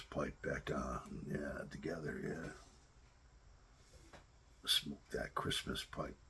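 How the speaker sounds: hushed, breathy whisper. Real, not acted. Inviting the viewer to smoke together, whispered. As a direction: whisper it like a shared secret. Lighting the Christmas pipe together.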